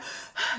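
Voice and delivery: quick unvoiced breath out, tense; a short breathy sigh high in the chest; shallow sigh. Shown as a demonstration. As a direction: demonstrate shallow panicked breathing. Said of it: "you're breathing super shallow".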